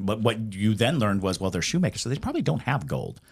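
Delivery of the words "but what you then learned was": ordinary delivery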